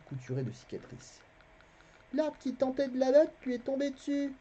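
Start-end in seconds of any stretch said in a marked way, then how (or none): 2.1-4.3 put-on boy lying. A narrator's reading. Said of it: "mentit Garion".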